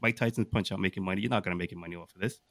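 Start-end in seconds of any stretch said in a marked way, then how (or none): none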